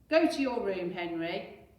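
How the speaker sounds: loud stern order; father ordering Henry upstairs; Dad shouting. Acted. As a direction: send him off with a shout. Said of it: "shouted Dad".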